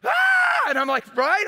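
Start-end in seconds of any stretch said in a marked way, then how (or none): 0.0-0.1 mock anguished "ah!"; imitated prayer outcry. Preacher imitating himself praying among leaders, imitated outcry. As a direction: cry out as if overcome in prayer.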